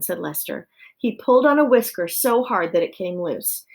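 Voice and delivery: plain reading voice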